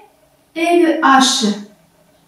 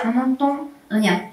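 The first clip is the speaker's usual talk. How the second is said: « on n'entend rien » repris tout bas; soft echo; 8 dB quieter than usual. Shown as a demonstration. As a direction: murmure l'écho, presque inaudible. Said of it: "mais on n'entend rien". (écho chuchoté, tout doux)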